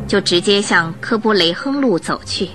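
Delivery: ordinary talk, nothing marked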